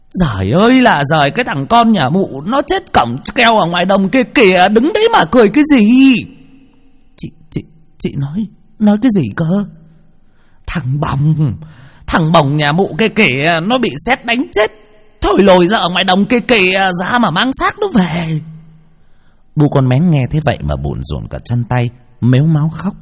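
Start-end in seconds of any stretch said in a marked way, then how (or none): 0.1-6.1 high, loud lament rising fast at the end; wailing scold. Acted. 7.2-9.7 high, stammering disbelief; stunned stammer. Acted. 10.7-18.4 high, urgent shrill telling; woman breaking bad news.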